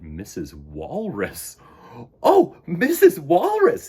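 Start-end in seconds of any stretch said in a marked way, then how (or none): 2.2-3.9 high, loud, slow cry; little bird crying out. Acted. Choco calling to Missus Walrus, high and loud. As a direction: call out with hope. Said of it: "he cried".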